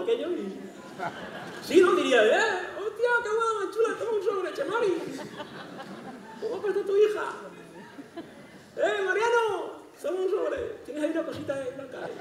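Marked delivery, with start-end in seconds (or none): 2.2-12.1 voz impostada muy aguda; Bárcenas. Retold or imitated. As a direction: imita a un invitado de boda confundido, agudo y cada vez más flojo. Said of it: "que el Bárcenas, pues, se confundiría". (voz aguda de invitado que reparte sobres)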